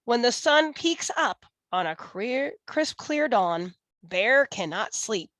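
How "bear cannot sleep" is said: plain reading voice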